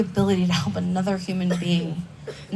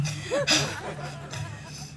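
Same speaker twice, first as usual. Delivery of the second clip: high soft laugh; laughing at the irony; real laugh; 10 semitones higher, 7 dB quieter than usual. Real, not acted. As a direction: break into a light laugh. Laughs at the punchline.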